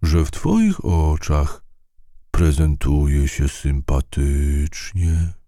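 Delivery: very slow, unhurried creature voice; a gentle snail taking its time; slow drawn-out snail reply. A narrator's reading. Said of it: "uśmiechnął się nieznajomy".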